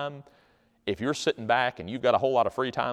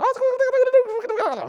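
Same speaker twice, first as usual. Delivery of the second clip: very high, loud babble; mock foreign chatter; 6 dB louder than usual. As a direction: imitate customers jabbering to a friend. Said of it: "turn to their friend and they're going".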